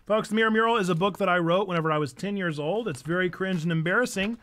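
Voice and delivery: ordinary talk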